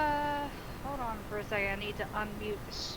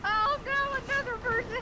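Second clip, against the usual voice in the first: mock panic; 13 semitones higher, 6 dB louder than usual. Real, not acted. Reacting to new viewer.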